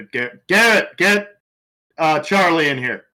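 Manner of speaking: urgent high, loud order; yelling out. Pretend. Agent summoning Charlie to the body, yelling out. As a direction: call loudly to a colleague out of the room. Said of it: "yell out".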